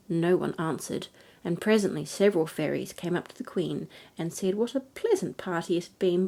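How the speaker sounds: plain reading voice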